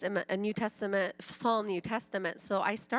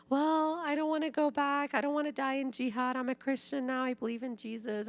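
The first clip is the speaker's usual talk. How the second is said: pleading brother; 7 semitones higher than usual. Retold or imitated. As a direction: beg not to be sent back. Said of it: "He was saying".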